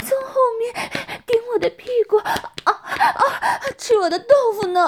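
high, loud wife's voice broken by moans; moaning and panting. Acted. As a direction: pant and moan between phrases. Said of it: "呻吟连连的娇喘". (高声呻吟娇喘)

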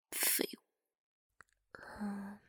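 contemptuous breathy whisper. Acted. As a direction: hiss it softly, scornful. Teasing whisper during countdown.

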